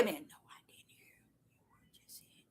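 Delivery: unvoiced hushed whisper. Real, not acted. Preacher whispers he missed the words, hushed. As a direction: drop to a whisper, checking yourself.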